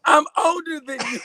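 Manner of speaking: high, loud surprised exclamation. Real, not acted. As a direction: blurt it out in disbelief. Surprised realization of being older.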